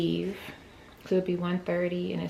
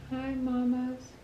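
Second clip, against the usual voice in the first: higher, slow sing-song greeting; 5 semitones higher, slower than usual. Real, not acted. Greeting baby.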